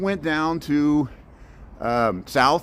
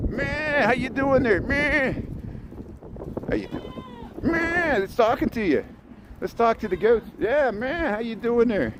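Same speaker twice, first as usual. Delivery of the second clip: much higher, playful voice for greeting the goats; talking to the goats; 10 semitones higher, 3 dB quieter than usual. Pretend. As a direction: chat up the goats in a high, goofy voice. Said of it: "See, I'm talking with the animals".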